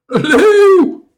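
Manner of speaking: very high, loud cry; alarmed shout. A narrator's reading. Dikkie Druif warns of a roaring beast, alarmed shout. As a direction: yell out a warning. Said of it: "riep Dikkie Druif".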